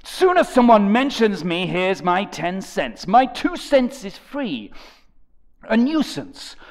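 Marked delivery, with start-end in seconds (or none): none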